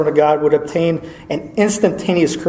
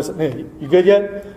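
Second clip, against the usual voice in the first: casual check-in; slower than usual. Pretend. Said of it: "So just imagine he's praying".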